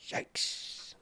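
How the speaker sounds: soft, unvoiced wince; whispered reaction of dismay; hushed yikes. Real, not acted. Streamer seeing the head in the trailer, hushed dismay.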